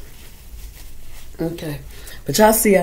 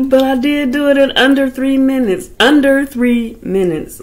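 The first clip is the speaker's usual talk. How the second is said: proud; 8 semitones higher, 8 dB louder than usual. Real, not acted. Proud of beating the time.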